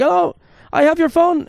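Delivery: high put-on voice of the taxi driver, slow. Taxi driver voiced in retold story.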